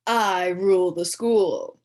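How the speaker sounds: slow, loud grand declaration; mock royal announcement. A narrator's reading. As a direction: proclaim it from the throne.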